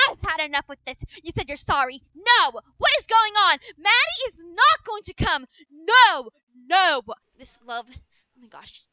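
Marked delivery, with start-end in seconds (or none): 2.2-7.0 loud flat no; furious mom forbidding; angry refusal. Acted. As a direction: shut it down hard. Mother refusing loudly.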